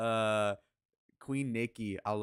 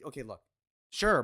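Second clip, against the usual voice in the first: usual speaking voice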